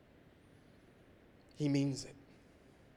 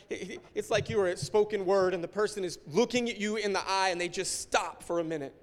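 ordinary talk

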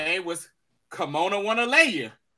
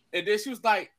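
ordinary talk, nothing marked

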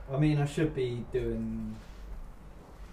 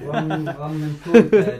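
laughing; 4 semitones higher, 13 dB louder, slower than usual. Real, not acted. Laughing while answering.

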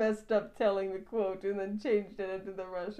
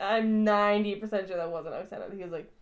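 normal talking voice

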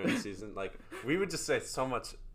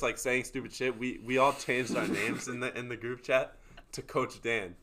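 ordinary delivery

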